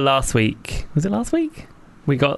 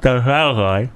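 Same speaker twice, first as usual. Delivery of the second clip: mouth held open; slower than usual. Real, not acted. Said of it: "the mouth is wide open".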